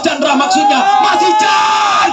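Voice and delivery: very high, long stretched goal scream; goal call. Real, not acted. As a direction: scream the goal at full power. Scores goal, repeated gol calls.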